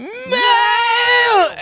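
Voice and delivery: a shrieking, helpless cackle of laughter; high shrieking laugh. Real, not acted. Cracking up at her own story, squealing laugh.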